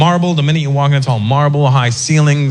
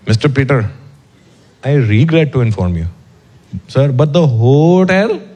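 put-on Indian accent. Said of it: "and he goes".

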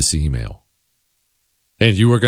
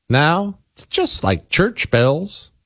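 slow, high-pitched mock reassurance; mocking echo; 9 semitones higher, slower than usual. Host parroting the police chief's excuse, mocking. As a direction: parrot the excuse in a drawn-out, higher voice.

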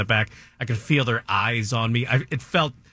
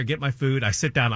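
ordinary talk, nothing marked

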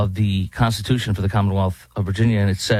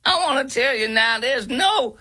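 very high, silly parody voice with giggles; mock a DEI video host, high and drawn-out; mock training-video presenter; 13 semitones higher, slower than usual. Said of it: "This is exactly what those videos sound".

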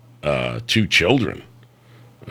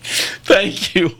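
laughing thanks; 10 semitones higher than usual. Real, not acted. Host laughing at caller's jab.

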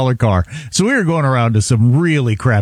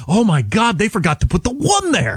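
higher, put-on gleeful discovery; mock excitement; 8 semitones higher than usual. Buddies thinking they found a bargain, imitated excitement. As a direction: pretend you lucked into a bargain. Retold naive buyers' mock delight.

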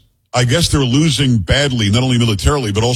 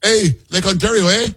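put-on Canadian accent; 8 semitones higher than usual. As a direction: ham up the Canadian "eh" up high. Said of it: "spot-on impersonation of this guy Ford".

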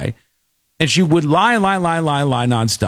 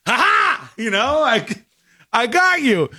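gloating cop; 10 semitones higher than usual. Retold or imitated. Cop gloating over caught liar.

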